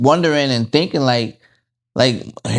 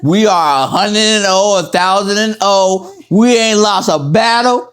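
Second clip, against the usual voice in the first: loud, higher hyped-up bragging; mock cocky hype; 7 semitones higher, 4 dB louder than usual. Husband imitating his own cocky bragging, loud and hyped. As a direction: pump it up like a champ before a fight. Hyped boasting about their past.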